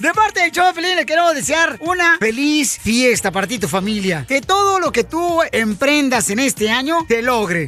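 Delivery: loud, booming holiday announcer; radio promo. Acted. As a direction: sell the greeting to the whole audience. (locutor de radio, fuerte y festivo)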